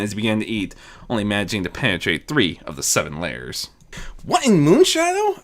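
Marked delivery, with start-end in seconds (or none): 4.2-5.4 high, startled demand; shocked. Acted. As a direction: blurt it out in shock. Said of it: "Azura demanded, shocked".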